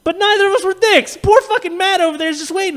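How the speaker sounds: very high, loud protest; worked up, pitch way up; exasperated rant. Real, not acted. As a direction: wail it in comic exasperation. Comic rant on their niceness.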